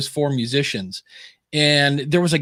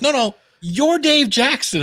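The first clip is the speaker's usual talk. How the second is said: high, exaggerated emphatic retelling; imitated stronger insistence; 9 semitones higher than usual. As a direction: insist harder, pitch pushed right up. Friend repeats, more insistent.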